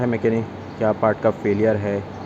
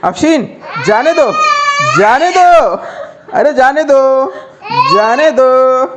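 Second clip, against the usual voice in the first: much higher, louder hammed-up whiny begging; mock pleading; 12 semitones higher, 6 dB louder, slower than usual. Father begs little Afsheen to let him leave: mock whiny pleading. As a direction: pretend to beg the child to let you go, laughing.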